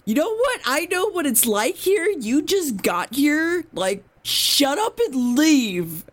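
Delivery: high-pitched, hammed-up tantrum, slowing at the end; mock temper tantrum. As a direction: play a sulky kid snapping leave me alone. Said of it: "throws a tiny temper tantrum".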